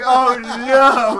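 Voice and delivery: laughing. Retold or imitated. Retold own panic, laughing.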